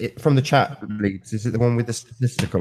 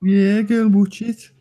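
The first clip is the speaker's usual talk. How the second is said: slow, higher-pitched Brummie imitation; Birmingham accent; 10 semitones higher, 4 dB louder, slower than usual. Echoing a friend's joke, in Birmingham accent. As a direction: draw it out in mock Birmingham.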